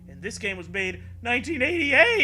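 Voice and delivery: mock outrage. Outraged discovery of date error.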